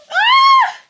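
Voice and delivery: a sudden high shriek of joy; excited high scream. Real, not acted. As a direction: let out a piercing happy squeal.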